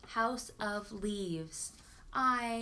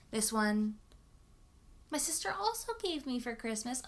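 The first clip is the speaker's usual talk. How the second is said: normal talking voice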